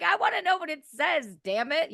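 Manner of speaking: high-pitched exasperated whine of impatience; maddened frustration. Retold or imitated. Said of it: "it was driving me mad".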